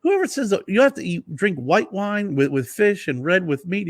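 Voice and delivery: high dismissive rant; Italian guy scoffing. Retold or imitated. Winery guy dismissing wine pairing rules, high and scoffing. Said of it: "He's like".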